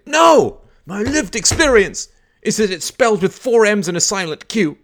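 loud, high, stubborn protest; hammed-up pupil shouting down a correction; mock indignant kid. Kid refuses teacher's correction, mock indignant. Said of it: "you thump the table and you say".

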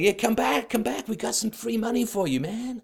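high-pitched wheedling dealer voice; coaxing drug pusher. Acted. Euro pushers tempting an addict back, coaxing drug pusher. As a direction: lure an addict back with a sly sales pitch. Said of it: "they're gonna be always out there saying".

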